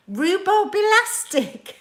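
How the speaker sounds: high, slow, drawn-out delivery; relishing the phrase. Acted. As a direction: stretch it out with relish. Relishing a viewer's swear word.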